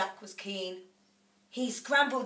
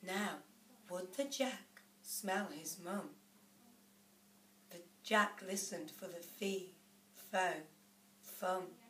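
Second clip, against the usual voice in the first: hushed suspense; 11 dB quieter than usual. A narrator's reading. Suspense as Jack waits below.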